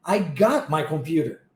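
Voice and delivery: "got" without a released T, low pitch; low phrase, T of "got" swallowed. Shown as a demonstration. Teacher modelling the whole phrase, T in "got" dropped. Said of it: "engulo esse T".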